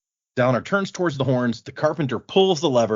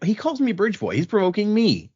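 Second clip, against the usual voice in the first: higher-pitched, mock-indignant complaint; indignant protest; 9 semitones higher than usual. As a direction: protest like a wronged party, playfully acted. Retold indignant complaint in quarrel.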